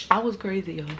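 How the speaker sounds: ordinary talk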